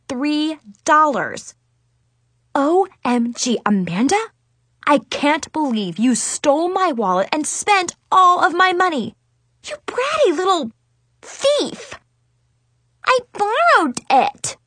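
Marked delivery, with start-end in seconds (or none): 2.5-11.9 outraged. Acted. Discovers money spent. 13.0-14.6 put-on high, slow, innocent kid voice; little girl voice.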